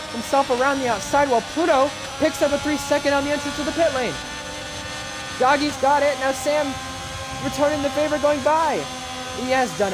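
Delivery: excited call with a shout; motorsport commentator. Acted. Live race play-by-play.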